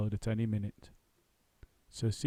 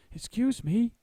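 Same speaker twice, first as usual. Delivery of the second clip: Paddington voice; 13 semitones higher, 4 dB louder, slower than usual. Acted. Paddington's voice, high.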